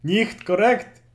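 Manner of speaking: loud, slow, high German rebuke; drawn-out German scolding with exaggerated force; mock-stern German. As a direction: play a German loudly pronouncing someone wrong. Mock German rebuke of co-host's age.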